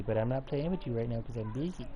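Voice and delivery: ordinary delivery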